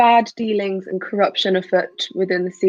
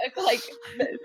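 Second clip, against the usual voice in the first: laughing; 3 semitones higher, 10 dB quieter, faster than usual. Real, not acted. Player laughing mid-sentence.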